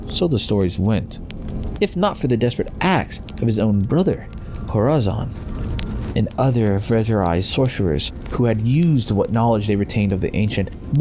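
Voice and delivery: ordinary delivery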